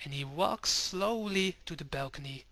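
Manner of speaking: ordinary delivery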